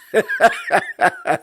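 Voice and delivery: a big burst of real laughter; loud real laugh. Real, not acted. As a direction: laugh out loud at your own joke. Laughs at own joke.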